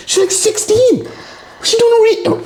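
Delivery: very high, squeaky disbelief; incredulous squeal. Real, not acted. Host reacts to a teen being in rehab, incredulous squeal. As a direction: shoot way up high in comic disbelief.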